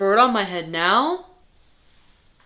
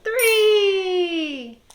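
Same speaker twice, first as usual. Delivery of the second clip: dismayed; 8 semitones higher than usual. Acted. Counting eggs, Gerald dismayed.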